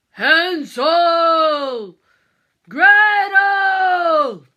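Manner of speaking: loud, far-carrying shout; calling out. Acted. Father searching the woods for his children, loud calling shout. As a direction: call across the forest for lost children.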